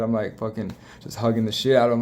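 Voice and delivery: ordinary talk, nothing marked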